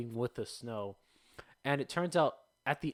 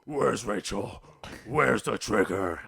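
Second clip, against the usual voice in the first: low, menacing gravel growl; evil Batman voice; 3 semitones lower, 5 dB louder, slower than usual. Retold or imitated. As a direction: growl it like a dark villain. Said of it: "I sounded so evil".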